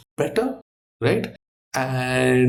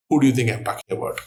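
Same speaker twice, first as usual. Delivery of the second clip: ordinary delivery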